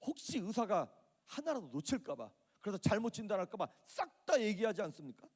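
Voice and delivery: ordinary delivery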